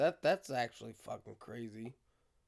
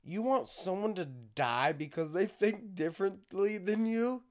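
usual speaking voice

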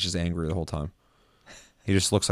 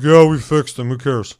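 careless staff voice; 3 semitones higher, 9 dB louder than usual. Retold or imitated.

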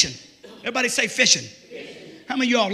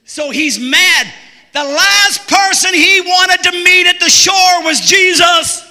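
full-force preaching; 7 semitones higher, 8 dB louder than usual. Acted. Preacher shouting the story of Peter to his church. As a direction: preach it out to the rafters.

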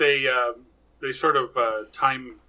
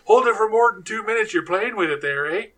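higher and louder, a hammed-up Canadian quip; mock Canadian accent; 8 semitones higher, 7 dB louder than usual. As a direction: ham up a Canadian camper's joke. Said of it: "every Canadian says that".

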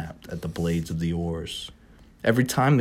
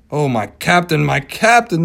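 pleading; 8 semitones higher, 8 dB louder than usual. Acted. Starbuck pleads weeping.

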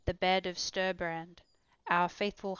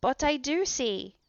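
higher, slow excited cry; cried out; 6 semitones higher, 5 dB louder, slower than usual. A narrator's reading. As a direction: call it out as you spot it. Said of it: "I cried".

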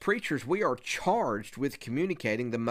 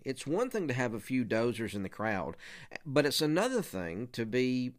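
normal talking voice

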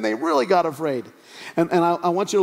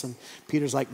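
ordinary delivery

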